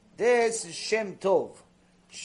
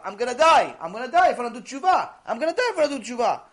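loud, raised, fast panicked repetition; retold screaming; 6 semitones higher, 7 dB louder, faster than usual. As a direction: cry it out like a terrified man, over and over. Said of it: "the guy continues to scream".

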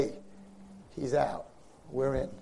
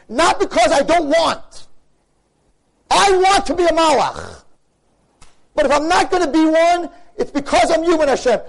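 raised, higher, louder pleading prayer; impassioned preaching; 10 semitones higher, 8 dB louder than usual. Acted. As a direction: cry out your longing to God with full force.

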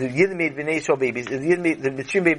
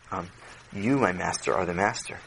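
meek servant; 8 semitones lower, 5 dB quieter than usual. Acted. Servant submits fearfully.

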